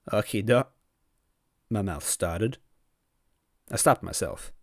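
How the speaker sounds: expressive audiobook narration with character voices; lively storyteller reading a fanfic; story narration. A narrator's reading. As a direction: read the fanfic aloud, carrying the listener along. Fanfiction read aloud with voiced characters.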